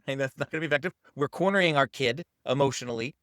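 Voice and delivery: ordinary talk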